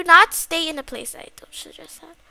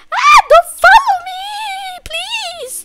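high, loud panicked shrieks; screaming for help; 11 semitones higher, 12 dB louder, slower than usual. Pretend. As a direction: shriek and plead to be left alone. Flees monster, calls for help.

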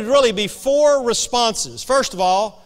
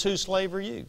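quiet; 9 dB quieter than usual. Shown as a demonstration. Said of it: "the title of this message".

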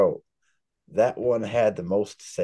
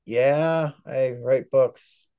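slow, drawn-out reluctant yes; sheepish admission; 4 semitones higher, much slower than usual. Retold or imitated. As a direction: imitate owning up shyly, stretching the words. Retells shy admission to coworkers.

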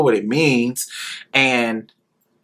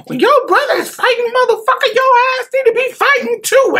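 cussing out; faster than usual. Retold or imitated. Mother cussing out the little brother for running, furious higher yell. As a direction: tear into him like a furious mother. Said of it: "my mother get to cussing my little brother out".

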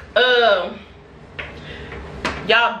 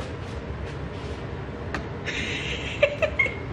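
breathless wheeze laugh; 11 dB quieter than usual. Real, not acted. Sees lashes in mirror.

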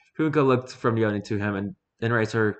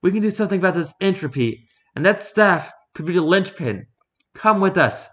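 higher, louder, pressing woman's voice; urgent Fumiko; 9 semitones higher, 4 dB louder than usual. Acted. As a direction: plead hard with him, pitch up.

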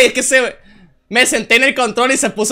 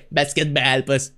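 put-on American accent; 9 semitones lower, 6 dB quieter, slower than usual. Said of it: "toda gringa".

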